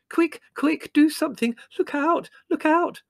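very high, urgent cries of alarm; panicked shrieking. Acted. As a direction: squeal for help in a panic. Margot panicking at scorpions.

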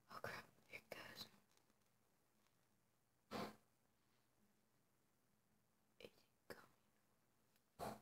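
barely audible whisper; very faint whisper. Real, not acted. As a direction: breathe the words out softly. Whispering while mask comes off.

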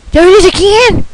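gasping, high and loud; surprised gasp. Real, not acted. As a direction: gasp as if it caught you off guard. Gasps at flash.

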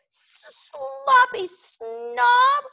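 high, very slow, loud sing-song jeer; jeering dragon. A narrator's reading. Toothless jeering at Fireworm, high and drawn out. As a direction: drag out the insult mockingly. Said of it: "jeered Toothless".

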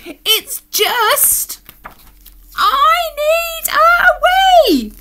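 high wail building to a long squealing shout; desperate shout. Acted. Alan bursting and dancing about, desperate shout. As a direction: draw it out at full, desperate pitch.

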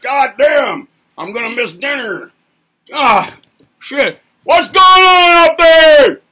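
building to a loud, high, slow shout; acted traffic frustration. Commuter stuck in rush hour yelling ahead, loud shout. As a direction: explode at the jam ahead.

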